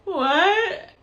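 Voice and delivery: high, loud startled what; sudden shocked outburst. Retold or imitated. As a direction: blurt it out in disbelief. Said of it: "And I was like".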